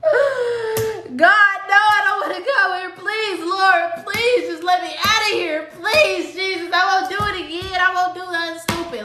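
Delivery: high, crying mock plea; hammed-up desperate prayer at the cell door; pleading to God. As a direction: beg Jesus half-laughing, half-wailing. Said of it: "this is me pleading to the Most High".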